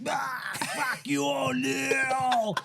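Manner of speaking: put-on high, slow drunken shout; drunk heckle. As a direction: bellow at the player from the stands. Drunk fan heckling player.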